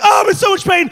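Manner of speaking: hammed-up wail of pain; mock pained wail. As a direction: cry it out in comic agony. Retold painful climax.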